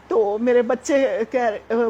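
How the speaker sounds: ordinary talk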